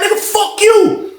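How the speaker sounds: slow, drawn-out curse in a high mock voice; retold cursing match. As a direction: stretch the imitated insult way up high. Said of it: "this cursing each other out".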